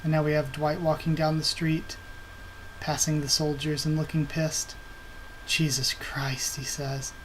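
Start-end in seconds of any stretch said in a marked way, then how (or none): 5.5-6.5 slow, soft, unvoiced whisper under the breath. Dwight, angry at the soldiers, mutters a slow whisper. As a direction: hiss it to yourself in disgust.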